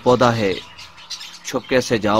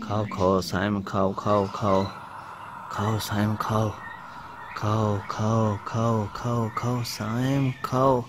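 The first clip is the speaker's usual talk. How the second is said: soft coaxing; 7 dB quieter than usual. Acted. Soft coaxing Saim to eat.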